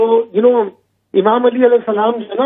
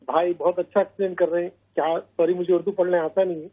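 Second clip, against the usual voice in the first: too quiet; 2 semitones lower, 7 dB quieter than usual. Real, not acted. Caller praising the hosts' programme, too quiet. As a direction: talk softly, hard to hear. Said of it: "آواز کم آ رہی ہے بہت".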